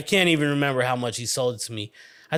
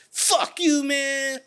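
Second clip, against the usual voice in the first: put-on kid yelling back; 13 semitones higher, much slower than usual. Kid yelling back while leaving.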